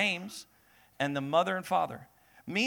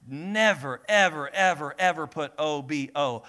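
each never and ever punched louder; emphatic never; 5 dB louder than usual. Acted. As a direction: hammer the never and the evers. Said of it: "I'm gonna just teach".

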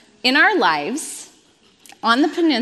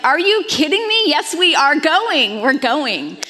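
husband's excited insistence; 5 semitones higher, 3 dB louder than usual. Retold or imitated. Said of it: "he's like".

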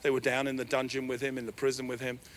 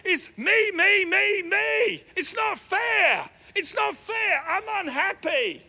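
put-on much higher, louder childish it's-not-fair wail; self-pitying Joseph; 7 dB louder, slower than usual. Preacher mocking Joseph's self-pity, high childish wail. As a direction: whine like a sulking child. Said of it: "Joseph is unhappy".

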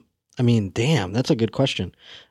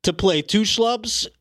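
mock meltdown; 5 semitones higher than usual. [name] raging at Nickelodeon execs who passed, hammed-up fury. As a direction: throw a fake tantrum at the network. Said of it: "Have a full-on meltdown right".

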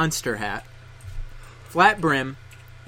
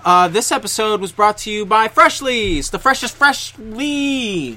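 higher, louder, slow announcer read; 6 semitones higher, 6 dB louder than usual. Acted. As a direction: sell it like a radio ad. Mock sponsor read.

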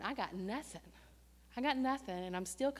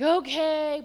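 loud forced okay; 6 semitones higher, 12 dB louder than usual. Retold or imitated. Forced agreement.

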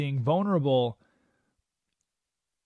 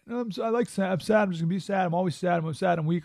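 hammed-up sad wallowing, pitched up; mock self-pity; 4 semitones higher, faster than usual. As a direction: mockingly insist on being miserable. Mocking someone wallowing in sadness.

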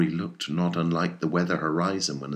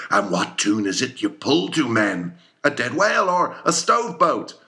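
rising louder and higher to a shout; fiercely glad; 7 semitones higher, 5 dB louder than usual. A narrator's reading. Captain drives the crew to a frenzy, fiercely glad. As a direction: build it into a roar. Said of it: "fiercely glad and approving".

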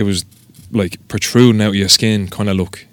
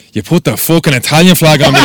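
loud, high, indignant accusation; retold outrage; 7 semitones higher, 7 dB louder than usual. Customer confronting tattooist over wrong flag, loud outrage. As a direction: burst out at the botched job.

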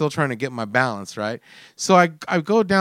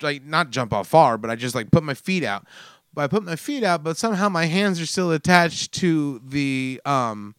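usual speaking voice

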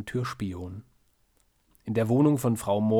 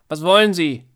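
put-on loud, higher old woman call; 6 semitones higher, 10 dB louder than usual. A narrator's reading. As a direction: call through a shut door. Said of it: "ruft sie".

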